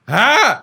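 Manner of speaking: high, loud imitated gasp-yelp; mock cry of alarm; startled yelp. Imitating a driver as his door opens, startled yelp.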